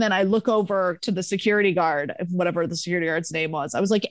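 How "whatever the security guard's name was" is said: ordinary delivery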